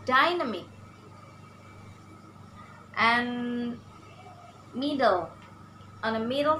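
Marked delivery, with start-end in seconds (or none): 0.0-0.6 "dynamic" with DY raised, the rest dropping; stress on first syllable. Shown as a demonstration. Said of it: "for example".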